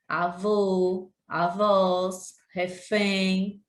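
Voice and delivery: normal talking voice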